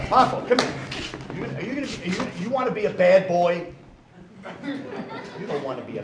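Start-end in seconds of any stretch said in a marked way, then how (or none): none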